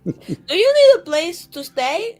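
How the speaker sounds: ordinary talk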